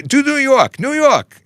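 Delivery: high-pitched imitation of excited kids; mock kid voice making a request; pleading kids. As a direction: mimic children egging you on, way up high. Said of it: "my kids were asking me".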